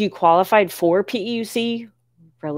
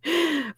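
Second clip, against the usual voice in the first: 1 soft high laugh; 10 semitones higher, 7 dB quieter than usual. Real, not acted. Viewer laughing at her.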